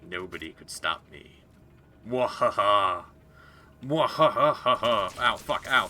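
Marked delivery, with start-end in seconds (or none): 2.1-5.2 loud evil cackle; Mandark laugh. Acted. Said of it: "my Mandark impression from Dexter's Laboratory". 5.2-5.9 loud sudden ow; pained yelp. Real, not acted. Sudden hurt in game.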